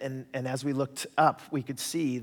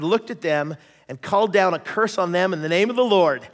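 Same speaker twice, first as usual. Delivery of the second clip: ordinary delivery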